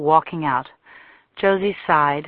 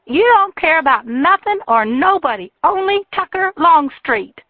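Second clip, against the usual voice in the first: shrill, much higher furious shout; furious woman; 12 semitones higher, 6 dB louder than usual. A narrator's reading. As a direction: hurl it at him, loud and high. Jilted woman rages at Tucker.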